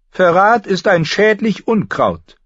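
high, loud solemn decree; solemn maester. A narrator's reading. Grand Maester Pycelle declares treason a weed, solemnly. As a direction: pronounce it like scripture. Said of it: "erklärte Pycelle feierlich".

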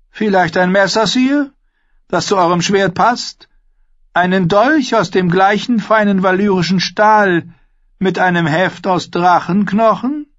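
high, carrying character voice; high-voiced courtly suggestion; suggesting uncle. A narrator's reading. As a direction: offer it as a question, pitched high.

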